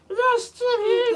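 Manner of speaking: very high, slow squeaky drone; high-pitched cartoon character squeal; Little Steve. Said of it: "that's how he sounds in the episode".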